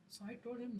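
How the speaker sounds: ordinary talk, nothing marked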